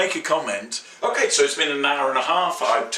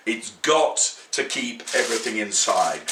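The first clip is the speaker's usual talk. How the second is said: usual speaking voice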